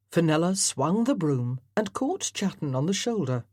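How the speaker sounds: ordinary delivery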